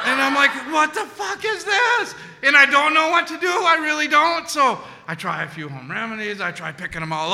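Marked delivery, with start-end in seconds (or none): 0.7-2.1 bewildered outburst. Retold or imitated. Bewildered teen.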